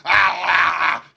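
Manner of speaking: very loud, high animal-like yells; feral screaming. Acted. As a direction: scream like a frothing madman. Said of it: "frothing at the mouth and".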